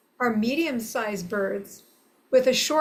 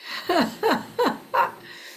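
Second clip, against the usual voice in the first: light laugh; 7 semitones higher than usual. Real, not acted. Laughs after bird call.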